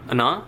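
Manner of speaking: ana with the first a swallowed, almost silent. Shown as a demonstration. Teacher demonstrating ana with the first a swallowed. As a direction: say ana, barely sounding the first a. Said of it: "See how I am kind of swallowing the A sound here".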